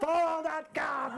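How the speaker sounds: high, slow, dramatic film-hero delivery with a laugh; live out the classic taxi line, relishing every word; hammed-up Hollywood cab chase order; movie chase line. Shown as a demonstration. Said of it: "my dream line that I've always wanted to say".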